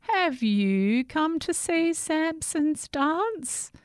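put-on high, slow old woman; sing-song like a kindly old woman. Old woman greets Jack.

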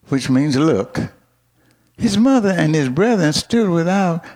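ordinary talk

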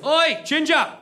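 high, loud British "oi"; British heckle from behind; aggressive British shout. Acted. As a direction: yell it like an aggressive British bloke. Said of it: "pretty aggressively".

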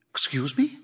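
soft, shocked query; taken aback, ask it softly. A narrator's reading. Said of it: "her voice shocked".

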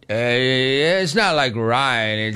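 very slow, drawn-out mock drunk; drunk gal. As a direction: drag it out like a drunk woman. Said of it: "talking to that drunk gal".

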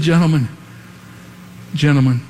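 normal talking voice

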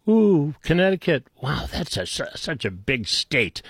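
hushed sarcastic admiration; sarcastic awe. Pretend. Mocks a senator's small state.

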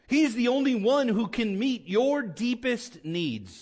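high, insistent pulpit voice; impassioned preaching. Real, not acted. Preaches with raised intensity.

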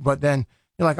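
ordinary talk, nothing marked

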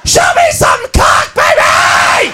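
loud raucous party yell; girl at ladies night, loud and rowdy; drunken shout. Acted. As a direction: holler it across the club. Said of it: "She's going".

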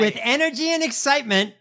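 high, loud mocking imitation, stretching "and"; mocking her read. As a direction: parody the stiff press read. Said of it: "your read on it".

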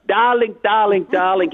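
high, sing-song Hungarian "Darling"; Hungarian accent. Retold or imitated. Ghost's signature cry haunting the widower, in a Hungarian accent. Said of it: "her signature cry".

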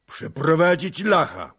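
loud, high shouted order; commanding call. A narrator's reading. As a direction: call the order out over the room. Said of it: "zawołał starszy kantarzyj". (głośne, rozkazujące wołanie)

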